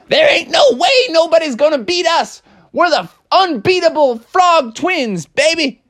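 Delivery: high, shouted bragging voice; cocky frog. Acted. Froggy boasting to the team, high cocky shout.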